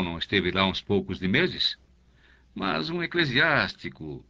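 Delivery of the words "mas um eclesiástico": higher, sly hint; a curate's sly insinuation; insinuating. A narrator's reading. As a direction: let the doubt hang, suggestive.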